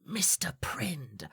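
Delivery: slow unvoiced whisper of the name. Selty whispering her mentor's name over the coin. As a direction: breathe the name like a spell. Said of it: "flips it and goes".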